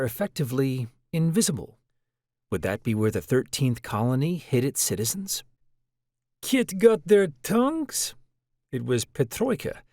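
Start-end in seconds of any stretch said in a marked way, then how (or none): 6.4-8.1 put-on light Russian accent, higher and slow. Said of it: "in a light Russian accent".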